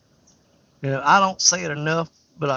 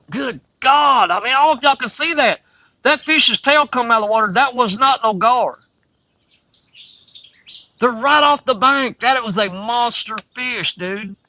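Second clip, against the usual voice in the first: higher, louder amazed outburst; louder, higher, excited exclamations; astonished; 6 semitones higher, 6 dB louder than usual. Real, not acted. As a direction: react to the fish tail breaking water. Sees a big fish jump.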